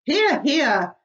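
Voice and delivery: high, loud agreeing cheer; cheering shout. Acted. Creatures cheering the ladybird's words, shouting agreement. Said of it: "they all shouted".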